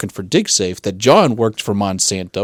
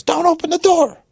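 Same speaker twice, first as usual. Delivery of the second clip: high-pitched mock yell of warning; mother yelling. Mother warning child off the back door, imitated yell. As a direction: imitate a panicked mother calling out. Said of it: "my mother just calls and yells".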